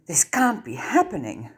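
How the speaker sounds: a grumbled complaint, pitched a touch up; grumbling. Acted. Hermione grumbles at the news of the law. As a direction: Hermione grumbling over the newspaper. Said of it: "Hermione grumbled".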